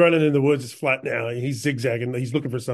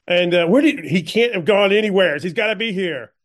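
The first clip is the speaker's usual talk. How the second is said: raised, higher, urgent searching voice; agitated pursuer; 6 semitones higher, 5 dB louder than usual. Acted. Pursuer hunting the man who shot Billy, agitated. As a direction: call out in baffled agitation.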